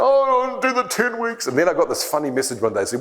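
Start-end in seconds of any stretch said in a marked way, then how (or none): 0.0-1.5 high, whiny grudging agreement; moaning reluctantly. Retold or imitated. Reluctant dieter agreeing to his coaches, high whiny moan. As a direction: give in with a sulky moan. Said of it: "I'd complain and moan".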